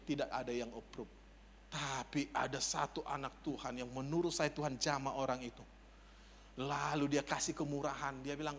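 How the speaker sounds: ordinary delivery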